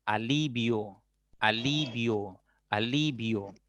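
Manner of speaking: "alivio" stretched on "li", falling away; slow pronunciation demo. Shown as a demonstration. Teacher giving an I-O example, "alivio" drawn out. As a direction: repeat "alivio" slowly, syllable by syllable. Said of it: "con la unión de I O".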